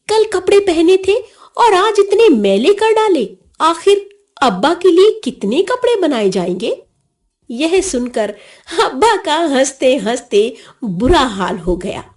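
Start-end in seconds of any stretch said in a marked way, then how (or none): 0.1-6.8 high-pitched exasperated telling-off; indignant, much higher voice; mock parental scolding. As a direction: nag like a fed-up parent. Boy scolds father about dirty clothes.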